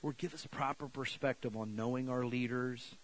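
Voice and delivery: prayer. Real, not acted. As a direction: pray quietly over the church. Closing prayer.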